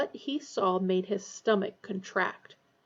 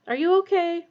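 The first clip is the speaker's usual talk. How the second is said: nervous, higher question; 8 semitones higher, 5 dB louder than usual. A narrator's reading. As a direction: ask it nervously. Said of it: "Ron asked nervously".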